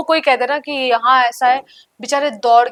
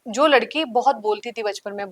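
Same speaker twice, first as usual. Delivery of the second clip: ordinary talk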